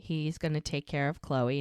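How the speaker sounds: ordinary talk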